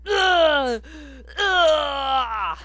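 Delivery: loud high retching; retching groan. Acted. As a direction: gag like you're puking. New guy puking.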